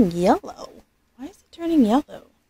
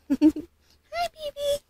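rising, higher, soft coo; baby-talk greeting; 4 dB quieter, much slower than usual. Pretend. Mom cooing hello back to her doll. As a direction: greet a newborn tenderly.

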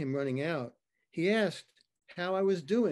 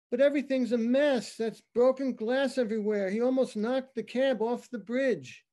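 higher, louder, urgent and rattled; panicked caller; 6 semitones higher, 4 dB louder than usual. Acted. Cab driver calling nine one one, panicked and loud. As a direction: blurt it out like a scared witness on the phone.

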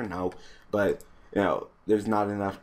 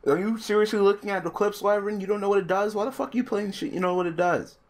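exasperated yelling; 11 semitones higher than usual. Real, not acted. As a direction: yell in disbelief at a clueless opponent. Yelling at opponent not using card.

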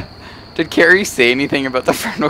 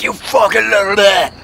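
mock cussing out; 6 semitones higher than usual. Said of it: "be like".

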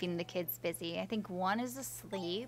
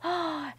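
sharp startled gasp; 9 semitones higher, 6 dB louder than usual. Retold or imitated. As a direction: gasp in mock fright. Spider story, sudden gasp.

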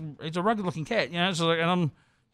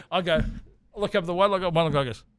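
normal talking voice

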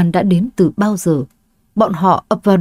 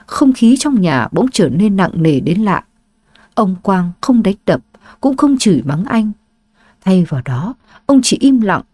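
usual speaking voice

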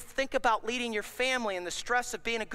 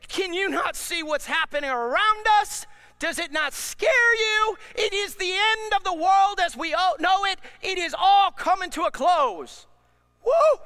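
mock panic; 8 semitones higher, 7 dB louder than usual. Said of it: "You may say".

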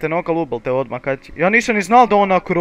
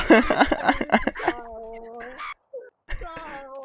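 long high burst of laughter; laughing; 12 semitones higher, 7 dB quieter than usual. Real, not acted. Teammates mocking a cheater.

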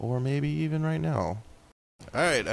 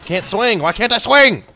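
higher, louder exasperated yell; frustrated shout; 10 semitones higher, 10 dB louder than usual. Real, not acted. Gamer unable to swing, loud frustrated outburst. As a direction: demand it of the game.

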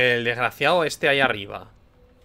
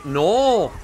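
higher, louder outburst of no; sudden dismay; 4 semitones higher, 4 dB louder than usual. Real, not acted. Gamer failing the escape, shocked no. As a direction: blurt it out in shock.

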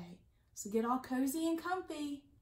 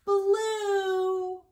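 higher and louder, a bright exclaimed reveal; excited reveal; 7 semitones higher, 7 dB louder than usual. Acted. Librarian revealing the colour to young listeners, excited. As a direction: ring out the colour like a happy surprise.

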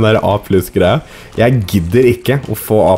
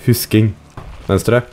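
usual speaking voice

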